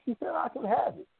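put-on little kid voice. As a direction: play the small kid defending herself. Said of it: "you know, I'm little".

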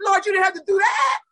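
high, loud playful complaint; mock outraged protest. Complaining to God like a child to Dad, loud and high. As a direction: cry out you didn't have to go that hard. Protesting God's hard assignment.

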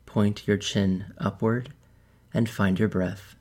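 calm meditation guide, unhurried with long pauses; measured, soothing guidance that leaves space to breathe; guided meditation. Acted. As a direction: lead them gently into relaxation, phrase by phrase.

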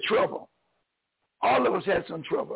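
ordinary talk, nothing marked

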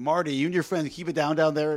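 imitated mom telling the boys off from upstairs; mother yelling down. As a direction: shout downstairs as a scolding mother. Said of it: "his mother yells down".

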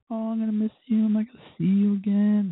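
high, needy mock pleading; whiny-sweet imitation of himself; clingy goodbye. Acting out his clingy goodbye.

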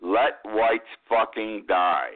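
quoting an outrageous line with heavy deliberation; slow, deliberate quote. Retold or imitated. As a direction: Let whites fucking die spaced out word by word, slow. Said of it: "I quote".

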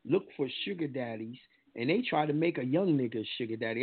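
normal talking voice

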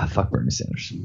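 low unvoiced mutter; muttered under breath. Real, not acted. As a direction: mutter it to yourself. Exasperated aside under breath.

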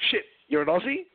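mock astonished client hearing an Aussie accent; surprised client. Client on the phone reacts to an Aussie recruiter, mock amazed and high. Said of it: "And they're like".

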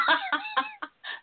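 shrieking laugh; high burst of laughter. Real, not acted. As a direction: break into a high squealing laugh. Host laughing at own joke.

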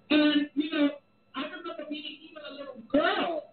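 ordinary delivery

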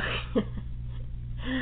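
soft breathy chuckle; quiet laugh. Real, not acted. Host laughing softly while praising the cast.

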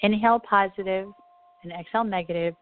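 calm, unhurried meditation voice; guided meditation. Acted. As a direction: lead them gently into calm. Leads a meditation.

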